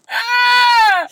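long, high scream; full-volume acted shriek; retold scream. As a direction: scream in mock terror at a swan. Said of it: "and it's me going".

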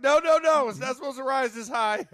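high, loud mock panic; mock alarm. Baker watching the dough rise too high, mock alarm. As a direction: panic over rising dough.

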